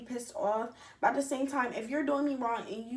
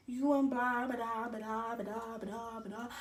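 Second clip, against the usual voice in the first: rattled-off filler fading softer; trailing away as placeholder chatter; dismissive blah-blah; 6 dB quieter, slower than usual. Retold or imitated. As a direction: skim over the message in a singsong run of blahs. Skimming over the text she sent.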